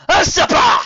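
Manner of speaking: very loud, high shout; furious queen roar; booming accusation. A narrator's reading. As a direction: hurl the insult at your sister. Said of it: "boomed Burning Sun".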